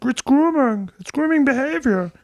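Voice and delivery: high, strained, lecturing Muppet-like voice; Jordan Peterson impression. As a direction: whine it like an indignant pundit. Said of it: "a little Jordan Peterson".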